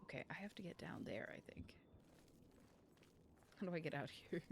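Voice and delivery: whispering while sneaking down. Real, not acted. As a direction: hush it like sneaking past guards.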